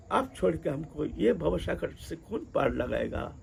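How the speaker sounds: soft, halting imitation of a penitent; humble pleading. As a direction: beg quietly for mercy in her role. Repentant woman begs saint for grace.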